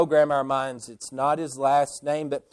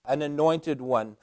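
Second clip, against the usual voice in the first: normal talking voice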